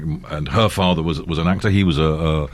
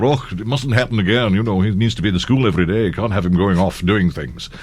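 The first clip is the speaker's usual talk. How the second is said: imitating a Scottish headmaster; disapproving Scottish schoolmaster; Scottish accent. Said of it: "your Scottish accent is way better".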